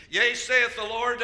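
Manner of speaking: slow, loud proclamation; prophetic declaration. Real, not acted. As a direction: pronounce it like a prophet, drawn out. Prophetic word in preaching.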